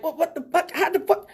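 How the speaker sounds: high, bewildered what the fuck; stunned disbelief. Retold or imitated. The audience watching the ice cream trick, high stunned disbelief. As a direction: act out a crowd that can't believe its eyes.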